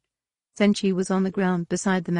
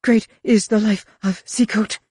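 urgent, higher, louder recitation; hasty prayer; 5 semitones higher, 5 dB louder than usual. A narrator's reading. Roel backing away from Mara, hasty prayer. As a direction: pray it out quickly and fearfully. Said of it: "he whispered hastily, as though in prayer".

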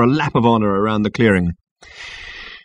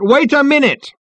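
high, loud, desperate yell; full-voiced objection, pitched right up; shouted protest; 5 dB louder than usual. A narrator's reading. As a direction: shout over a cheering crowd. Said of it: "shouted Ford Prefect".

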